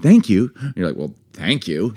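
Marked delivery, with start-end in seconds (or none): 0.0-0.5 louder, simple thanks, falling end; straightforward, upbeat thanks; sincere thanks. Shown as a demonstration. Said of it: "instead of like". 1.0-1.9 sarcastic thanks with rising end; pointed, questioning thanks; insincere thanks. Shown as a demonstration. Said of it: "Doesn't mean thank you".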